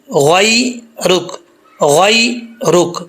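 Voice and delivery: غی رک drawn out slowly, syllable by syllable; slow careful ghai-ruk. Shown as a demonstration. Teacher slowly modelling غی رک for learners. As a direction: spell out غی رک slowly and clearly. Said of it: "غی پڑھیں گے تو غلطی".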